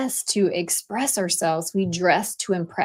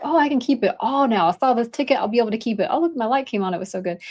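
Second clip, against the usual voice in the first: quick, eager, pitched-up waiter; excited waiter voice; 4 semitones higher, 3 dB louder, faster than usual. Retold or imitated. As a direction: play the giddy server who keeps every tip. Imitating the waiter going solo.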